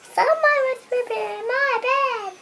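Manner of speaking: high-pitched storybook mama bear voice. Acted. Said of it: "My mama said".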